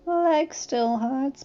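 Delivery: high, very slow creature voice; slow, high-pitched pitiful complaint; pained young dragon. A narrator's reading. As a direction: drag it out as a hurt whimper.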